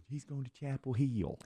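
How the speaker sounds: mother insisting on Chapel Hill, fake Southern accent. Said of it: "She goes".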